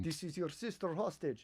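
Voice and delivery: Serbian mafia. Acted. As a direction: menace like a mobster with a put-on Eastern European accent. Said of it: "When the Serbian mafia comes in".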